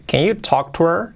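brisk natural-speed model. Shown as a demonstration. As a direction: run the words together, rising at the end. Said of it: "Con más fluidez".

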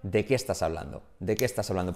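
"¿De qué estás hablando?" as one single word; words run together. Shown as a demonstration.

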